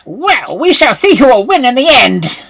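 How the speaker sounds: high, loud menacing witch; witch in great rage. A narrator's reading. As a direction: threaten the girl with seething rage. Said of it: "said the witch in a great rage".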